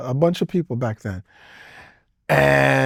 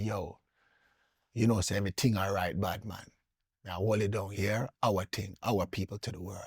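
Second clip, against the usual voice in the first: hushed, much softer retold aside; imitating a whisper; 7 semitones lower, 15 dB quieter than usual. Manager whispering in his ear in patois, hushed. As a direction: lean in and murmur it like a secret. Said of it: "whispered in my ear".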